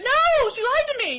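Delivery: high, loud indignant outburst; indignant protest. Retold or imitated. Answering her sponsor's question, high and loud outrage. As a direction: fire it back at her, outraged.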